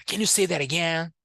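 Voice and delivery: high, fast movie line, "that again" flapped; imitating a film actor, "that again" run together; film character's linked speech. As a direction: toss out "that again" as "dera-gain". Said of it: "e o cara fala".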